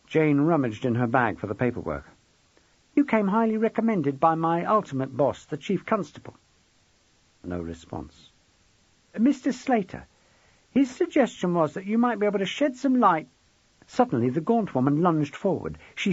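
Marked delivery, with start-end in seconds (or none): 2.9-13.3 high-pitched, polite detective voice; young detective. A narrator's reading. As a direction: speak up high, making an official request.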